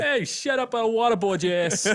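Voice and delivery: high, loud New York shout; mock threat in a New York accent; hammed-up New York tough talk. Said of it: "It's a New York".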